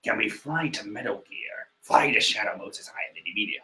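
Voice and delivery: low gravel-edged Snake impression, half whispered; Solid Snake voice. Luffy asking Nami to fly, done as Solid Snake. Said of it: "Luffy is voiced by Solid Snake".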